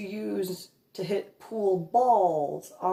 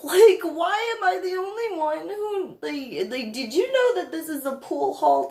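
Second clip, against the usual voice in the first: exasperated; 8 semitones higher, 3 dB louder than usual. Retold or imitated. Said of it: "And I looked at him like".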